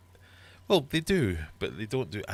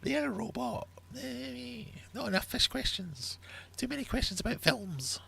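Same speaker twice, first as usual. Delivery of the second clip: high, whining quiz players; mock complaints, pitched high; whiny complainer; 3 dB quieter than usual. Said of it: "the amount of complaining".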